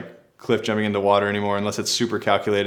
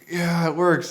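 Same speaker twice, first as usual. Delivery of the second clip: stoked; 6 semitones higher, slower than usual. Retold or imitated. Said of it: "I was just like".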